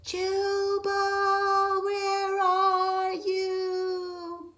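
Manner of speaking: long, very slow high call; far-off voice searching for Jubal; faint distant call. Acted. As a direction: call from far away across the storm. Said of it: "A faint voice called from a distance".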